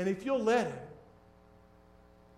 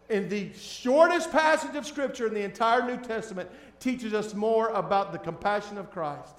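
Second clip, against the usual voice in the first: ordinary delivery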